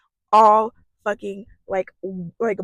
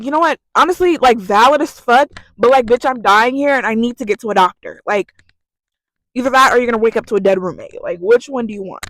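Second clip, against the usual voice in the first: loud, emphatic retold argument; insistent plea; 8 dB louder than usual. Sick student pressing roommate for a ride to doctor, insistent plea. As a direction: push it out loudly, making your case.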